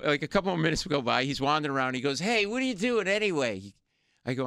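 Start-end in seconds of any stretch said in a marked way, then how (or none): 2.2-3.7 higher-pitched, challenging question; nosy drunk heckler. Retold or imitated. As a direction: imitate a bar drunk butting in. Voices the slashed man questioning him.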